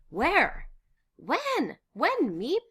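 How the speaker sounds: high, loud, urgent questions; eager questioning. Acted. Recovering his wits, questioning Miep, excited. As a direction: fire questions in sheer excitement. Stunned questions after news.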